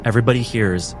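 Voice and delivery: ordinary talk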